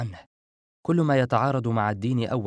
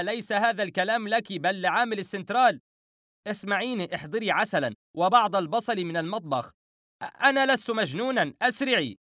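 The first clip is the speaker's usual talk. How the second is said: high-pitched, frantic phone orders; frantic shouting; 10 semitones higher than usual. Acted. Narrator yelling at the operator and Howaida, frantic. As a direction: cry out urgently, voice pitched way up. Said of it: "والتقطتها وصرخت".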